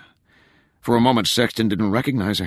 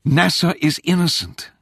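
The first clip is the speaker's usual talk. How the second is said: high-pitched, insistent daughter; heated, raised-pitch insistence; indignant protest; 9 semitones higher, 3 dB louder than usual. A narrator's reading. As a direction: confront your father with urgent indignation. Daughter angrily confronts her father.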